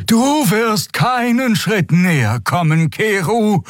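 slow, high, commanding warning; threatening. A narrator's reading. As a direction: forbid any step closer, word by word. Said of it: "sie könne ihre Drohung wahrmachen". (drohend befehlend)

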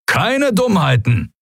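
high, drawn-out warning; stern warning. A narrator's reading. Commander to prisoners as the field drops, stern warning. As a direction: warn them off slowly and forcefully.

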